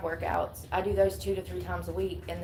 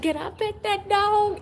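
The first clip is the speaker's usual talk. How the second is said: very high, squeaky pleading; higher meme voice; 12 semitones higher, 5 dB louder than usual. Retold or imitated. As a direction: push it up into a squeal. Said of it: "Y'all remember that?".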